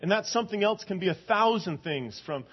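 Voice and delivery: high, loud preacher's plea; fervent sermon build; impassioned preaching. Acted. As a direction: drive the question home from the pulpit. Preaching about idols.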